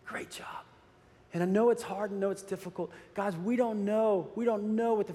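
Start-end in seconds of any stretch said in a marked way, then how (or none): 0.1-0.7 breathy whisper of praise. Retold or imitated. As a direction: breathe the words out softly.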